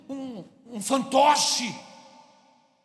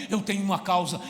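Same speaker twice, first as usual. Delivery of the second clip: ordinary delivery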